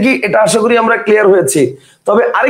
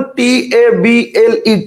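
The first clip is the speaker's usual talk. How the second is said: slow spelling; much slower than usual. Shown as a demonstration. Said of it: "teacher spells word slowly".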